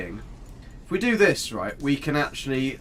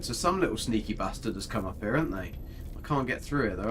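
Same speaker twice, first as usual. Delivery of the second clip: ordinary talk, nothing marked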